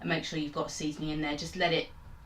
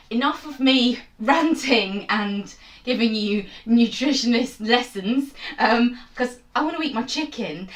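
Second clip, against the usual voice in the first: laughing; 5 semitones higher, 8 dB louder than usual. Real, not acted. Laughs at her own rant.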